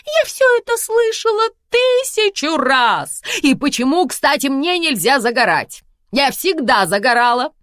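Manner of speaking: high, loud theatrical protest; a vain actress defending herself; mother protesting to her daughter; indignant diva mother. Acted.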